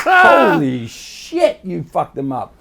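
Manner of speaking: drawn-out high shout of disbelief; amazed mobster buddies. Retold or imitated. Mob friends cheering him in the parking lot, amazed. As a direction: stretch out holy shit in awe. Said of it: "and they're like".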